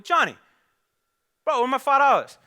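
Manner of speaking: high, loud call-out for the money; loud demand. Acted. Luke yells at Johnny for his five dollars, loud and high. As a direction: shout across the street for your cash.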